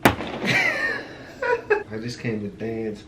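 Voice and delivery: slow, laughing joke line. Real, not acted. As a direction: laugh it out slowly.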